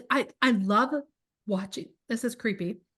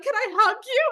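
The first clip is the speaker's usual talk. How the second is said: high emotional ask; 10 semitones higher, 3 dB louder than usual. Retold or imitated. Emotional moment with fan.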